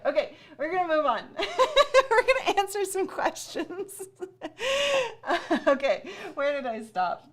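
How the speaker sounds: laughing high through near tears, fast; teary laughter. Real, not acted. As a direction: laugh it off before the tears come. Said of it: "'cause I'm gonna cry".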